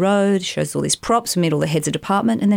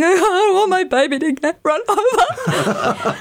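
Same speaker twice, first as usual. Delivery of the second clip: much higher, slow laughing mock-mum wail; fretting mum; 13 semitones higher, slower than usual. Imitating her mother refusing the film role, laughing. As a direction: play the worried mother, half laughing. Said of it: "My mum's like".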